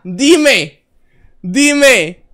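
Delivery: high, loud call to answer; loud demand. Acted. Streamer calls on a viewer to speak, loud demand. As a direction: belt out each word as a demand.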